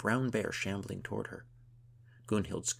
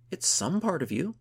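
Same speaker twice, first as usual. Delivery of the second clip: high, loud, eager assurance; giddy insistence; 10 semitones higher, 8 dB louder than usual. A narrator's reading. Gunhild urges her friend to accept it, giddily. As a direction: insist on it brightly and loudly.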